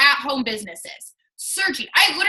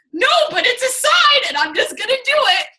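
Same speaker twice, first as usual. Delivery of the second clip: high, loud, fast insistence; determined and giddy, won't be stopped; insistent excitement; 10 semitones higher, 4 dB louder than usual. Retold or imitated. Insisting to husband.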